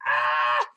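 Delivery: sudden loud mock scream; imitated startled scream. Retelling being scared by Pyramid Head, imitated scream. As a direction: let out a short, loud "Ah".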